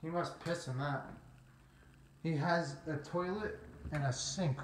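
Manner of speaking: ordinary delivery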